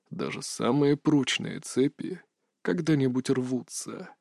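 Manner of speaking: unvoiced rustling whisper, very soft. A narrator's reading. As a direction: breathe it out like dry leaves stirring. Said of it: "прошелестел голос".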